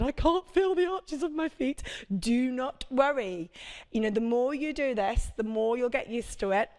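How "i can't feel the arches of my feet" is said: a frustrated class member's thought, pitched higher; frustrated beginner. Pretend. Instructor voicing a struggling class member's worry, higher and puzzled. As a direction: voice a puzzled mum who can't feel it. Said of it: "and you're thinking".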